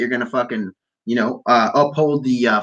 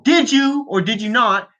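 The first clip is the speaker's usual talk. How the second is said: mock interrogation; 8 semitones higher than usual. Podcaster questioning an absent politician, mock interrogation. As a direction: put the question like a moderator.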